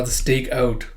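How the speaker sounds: ordinary delivery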